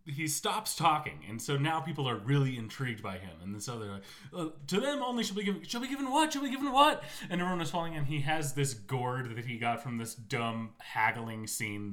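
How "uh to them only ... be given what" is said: high, fast, pleading follower voice, climbing higher; eager follower. Retold or imitated. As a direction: beg for the rest of the prophecy, higher and higher. Eager crowd begging the prophet.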